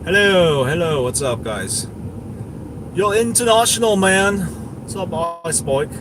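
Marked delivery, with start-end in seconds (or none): none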